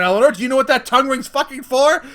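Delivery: loud, fast furious question at her daughter; angry yell. Retold or imitated. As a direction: bark it at her like a furious mom. Angry mom grilling her daughter.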